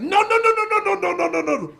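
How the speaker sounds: high, loud frantic string of no's. Retold or imitated. Stranger warning him off the seat, loud panicked no's. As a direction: stop him from sitting, panicked. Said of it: "he was like".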